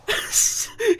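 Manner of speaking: high, wheezy laugh; burst of laughter. Real, not acted. Player hearing the storyteller's punchline, bursting out laughing. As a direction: crack up suddenly.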